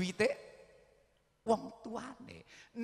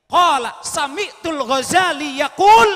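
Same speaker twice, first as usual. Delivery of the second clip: preaching at full force; 7 semitones higher, 15 dB louder than usual. Acted. Arabic chain of narration.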